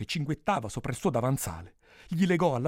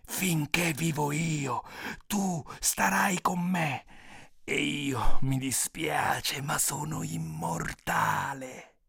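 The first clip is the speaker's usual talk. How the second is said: very slow, heavy ogre voice; ogre's slow, stretched-out refusal; menacing ogre; 3 semitones higher, 3 dB quieter, much slower than usual. A narrator's reading. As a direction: draw each word out, immovable. Ogre answers the pleading princess.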